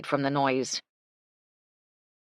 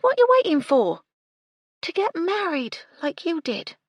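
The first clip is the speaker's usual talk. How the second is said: a pert, higher maid's voice; cheeky young maid; 13 semitones higher, 6 dB louder than usual. A narrator's reading. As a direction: pitch it up as a nosy cockney maid.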